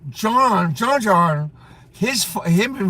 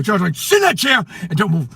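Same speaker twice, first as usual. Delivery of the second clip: ordinary talk, nothing marked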